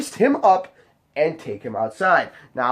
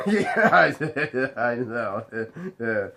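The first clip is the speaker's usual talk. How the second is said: laughing through the admission; words broken up by chuckles; sheepish laugh; 3 semitones lower, slower than usual. Retold or imitated. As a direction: act out a guy chuckling as he admits it. Acted drunk guy laughing it off.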